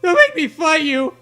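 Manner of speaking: ordinary talk, nothing marked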